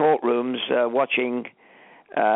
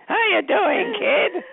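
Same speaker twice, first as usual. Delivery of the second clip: put-on old man voice; much slower than usual. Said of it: "that was my old man voice".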